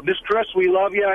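ordinary talk, nothing marked

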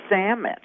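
"sandwich" slurred to SAM-mitch; regional pronunciation. Shown as a demonstration. Showing the host a third regional way, "sandwich" as sammich. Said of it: "the word sandwich differently".

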